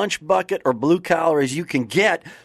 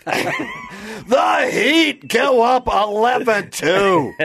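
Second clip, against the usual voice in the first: slow, shouted announcer call; home run call; 8 semitones higher, 4 dB louder, much slower than usual. Pretend. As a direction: stretch it out like a radio announcer.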